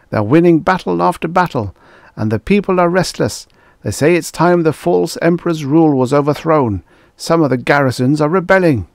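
high, loud and fast, tumbling out in alarm; panicked report. A narrator's reading. Prime Minister reports lost battles to the Emperor, frantic and high. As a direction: rush the bad news in a frightened rush. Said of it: "Tors burst out unheedingly".

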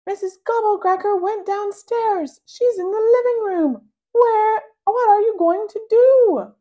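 high, loud excited yell. A narrator's reading. Little sister yelling news to brother. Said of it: "she yells".

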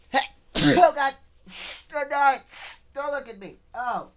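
high, panicked yelps; real fright, cry out in shock; startled panic. Real, not acted. Jump-scared by an animatronic, startled panicky cries.

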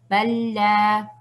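balla lento, con l larga y sostenida; salto a la shadda. Shown as a demonstration. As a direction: refuerza la l doble de balla. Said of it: "saltar directamente a la shadda". (balla de corrido, apoyado en la shadda)

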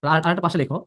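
ordinary delivery

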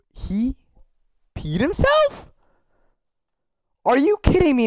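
jumps high in disbelief; shocked disbelief. Real, not acted. Streamer shocked his character peed himself, high disbelief. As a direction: gasp it out, stunned.

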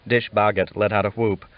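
ordinary delivery

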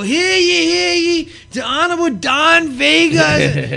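high, loud, drawn-out announcement; bailiff's proclamation. Retold or imitated. Bailiff introducing the judge in the ring, loud proclamation. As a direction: cry it out like a mock town crier.

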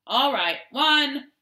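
loud playground count. A narrator's reading. Johnny starting hide and seek, counting loudly. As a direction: call out the count for everyone to hear.

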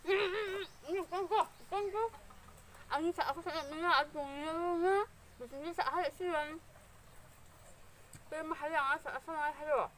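talking with food in mouth; eating and speaking; chewing while talking. Real, not acted. Seen 2: Eats corn by hand; mouth working on food between words.

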